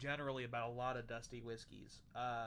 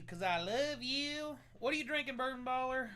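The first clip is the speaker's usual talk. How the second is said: put-on playful Southern accent, pitched higher and louder; 11 semitones higher, 5 dB louder than usual. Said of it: "I'm gonna slip into my southern accent, apparently".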